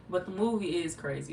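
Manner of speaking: ordinary talk, nothing marked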